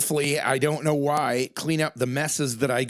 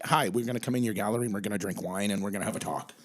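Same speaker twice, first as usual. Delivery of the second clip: imitating partner on phone; 6 semitones lower, 4 dB quieter, faster than usual. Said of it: "right away you're like".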